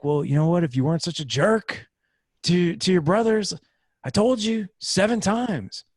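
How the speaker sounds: imagined yell back. Retold or imitated. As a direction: play the dad snapping back at his son. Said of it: "I just wanted to yell back".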